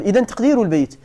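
ordinary delivery

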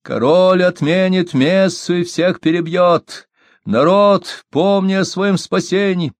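high, slow ranting preacher's bark; loud soapbox ranting. A narrator's reading. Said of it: "вернее, лай".

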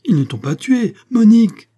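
high and loud, peaking on her name; desperate call. A narrator's reading. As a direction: shout it with relief and panic. Marin calling to Monique. (Marin crie à Monique, haut et fort)

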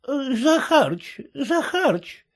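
высокий медленный робкий старушечий голос; тихонько позови, робея, как старуха; робкая старуха. A narrator's reading. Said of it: "робко трогала за плечо". (робкий голос старухи)

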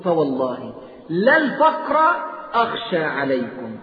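slow, weighty recited hadith; solemn recitation. Acted. Preacher to the congregation, slow solemn hadith recitation. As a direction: dwell on each word of the oath.

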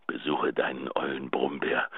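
soft intimate whisper. Acted. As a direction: breathe it close to the mic like a secret. Tender goodbye on answering machine.

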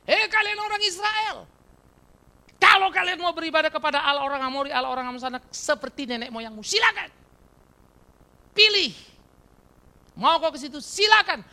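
high, loud commanding general's address; Joshua's speech. Acted. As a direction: thunder it out like Joshua before Israel. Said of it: "Ayo pidato Yosua". (pidato Yosua yang lantang dan tegas)

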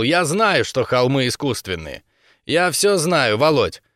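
ordinary delivery

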